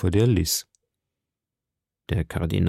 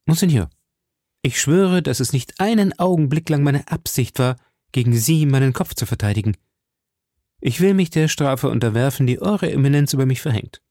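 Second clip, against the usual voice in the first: higher, ardent avowal; earnest young musketeer; 4 semitones higher than usual. Acted. Musketeer submitting to the cardinal's punishment, fearlessly earnest. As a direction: swear it earnestly and proudly.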